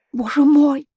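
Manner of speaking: Боже мой cried out high and loud; a high, loud, broken Боже мой; despairing cry. A narrator's reading. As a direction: fling out a desperate Боже мой. Despairing exclamation.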